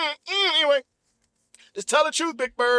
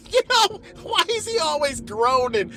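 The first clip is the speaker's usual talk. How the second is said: ordinary talk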